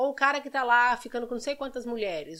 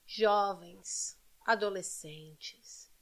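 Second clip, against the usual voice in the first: soft, lower, slow start, almost whispered; hushed appeal; 4 semitones lower, 6 dB quieter, slower than usual. Real, not acted. Host appealing to young viewers, hushed and earnest.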